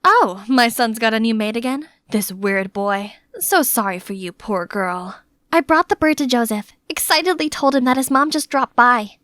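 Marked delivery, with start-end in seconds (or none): 0.0-5.2 mock the new maid with fake sympathy; sarcastic. Mother sneering at the new maid, sarcastic. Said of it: "just sarcastically said".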